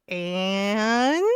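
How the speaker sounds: "And?" stretched long and loud, rising; drawn-out "And?". Retold or imitated. Streamer retelling her comeback to an insult, loud drawn-out "And?". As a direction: drag out "And?" into a challenge.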